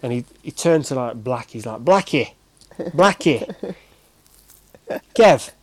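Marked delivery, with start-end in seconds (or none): 1.8-5.5 loud, high-pitched mock manager outburst; impression of manager. Manager sizing up his new squad, loud mock dismay. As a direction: call it out like an exasperated boss, drawn out. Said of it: "in his Yorkshire accent, I'm not gonna do his accent".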